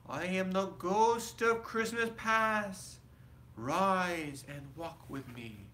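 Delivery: high, airy spirit voice; ghostly spirit voice. A narrator's reading. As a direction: speak as a gentle spirit. Ghost of Christmas past speaks.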